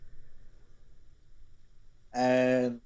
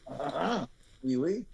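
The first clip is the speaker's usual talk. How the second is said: light mock French "oui, oui"; a hint of a French accent; playful put-on French accent. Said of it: "Need to work on my French".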